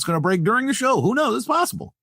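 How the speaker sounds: high, teasing excitement; mock hype. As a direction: pitch it way up as a playful tease. Hyping possible news during show.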